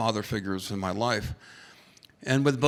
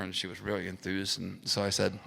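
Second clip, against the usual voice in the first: ordinary talk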